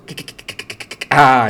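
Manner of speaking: stuck on "K", repeated in unvoiced bursts; blocked, clicking "K" repetitions; stammer on K. Shown as a demonstration. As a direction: stutter "K" over and over until it bursts out. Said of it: "orang gagap memang payah nak sebut".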